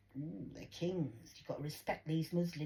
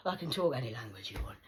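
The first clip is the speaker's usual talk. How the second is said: ordinary talk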